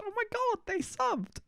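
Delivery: very high, thrilled squeal; inner squeal. Pretend. Picturing a new subscriber, a thrilled inner squeal. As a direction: squeal like a fan who just got noticed. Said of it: "a little bit of me inside goes".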